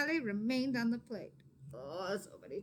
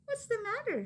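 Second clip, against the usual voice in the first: much higher, gentle asking; concerned mother; 13 semitones higher than usual. Acted. Mama to her worried daughter, higher concerned voice.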